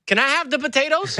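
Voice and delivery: high-pitched mock yell from the back; retold yell. Kitchen lady asking customer from the back, imitated yell. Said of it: "she yelled back at me".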